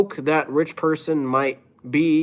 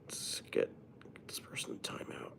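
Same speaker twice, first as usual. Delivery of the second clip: whisper; 22 dB quieter than usual. Real, not acted. Hushed aside to chat.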